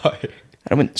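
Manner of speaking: usual speaking voice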